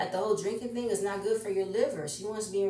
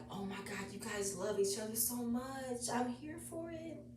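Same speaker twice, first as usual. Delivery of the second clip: much softer and higher, gushing; 6 semitones higher, 8 dB quieter than usual. Retold or imitated. Watching the couple hug, soft high gushing. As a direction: coo it like melting at a cute couple. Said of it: "I just like".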